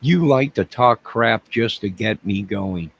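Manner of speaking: ordinary delivery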